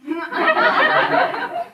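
high-pitched chuckle; high soft laugh. Real, not acted. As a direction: laugh lightly at yourself. Preacher laughs at own story.

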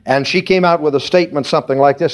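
ordinary talk, nothing marked

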